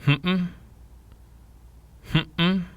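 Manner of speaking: "Hmm-mm" broken in the middle, jumping high; wave tone, lips closed. Shown as a demonstration. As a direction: hum "Hmm-mm" with a catch, then rise. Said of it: "say this tone with our lips closed".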